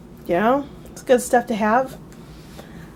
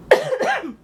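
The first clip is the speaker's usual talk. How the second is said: coughing. Real, not acted. Coughing spell continues.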